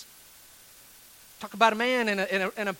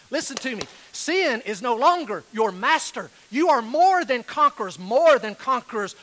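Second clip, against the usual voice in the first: louder, rising exhortation; preaching at full force; 4 semitones higher, 5 dB louder than usual. Acted. Pastor urging his bitter listeners, full-force preaching. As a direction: hammer it home to the church.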